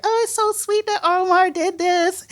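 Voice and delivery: imitated high-pitched gushing; super happy. Colleagues delighted about the boat trip, super happy. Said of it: "super happy".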